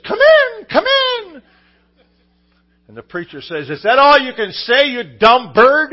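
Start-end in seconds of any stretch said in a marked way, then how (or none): 0.1-1.4 very high, much louder falsetto squawk; a parrot calling out its welcome; high loud parrot come in. Acted. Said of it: "And the parrot says". 3.7-5.9 much louder, higher, slowing at the end; preacher pinned under the dog yells at the parrot; exasperated yell. Acted.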